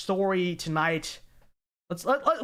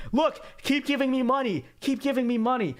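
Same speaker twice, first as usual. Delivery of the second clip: higher-pitched, mildly mocking plea for cash; mock pleading demand; 6 semitones higher than usual. Commentator voicing a president asking the West for funds, mock pleading. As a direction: imitate an insistent beggar, pitched up.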